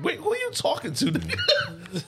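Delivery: high-pitched, incredulous comeback with a laugh; laughing, squeaky outrage; mock indignation. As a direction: answer the villain in comic disbelief. Acted indignant reply to villain.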